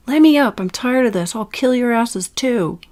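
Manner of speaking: loud, furious, then slowing threats; angry outburst. Acted. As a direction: fight the restraints and yell. Restrained heroine threatens captors.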